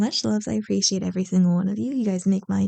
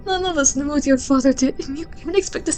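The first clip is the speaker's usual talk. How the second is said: higher, stammering disbelief rising into pleading and accusation; shocked and hurt; 5 semitones higher than usual. Acted. Victim confronting the killer at the dinner party, higher and shaken. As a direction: confront the friend who turned out a killer.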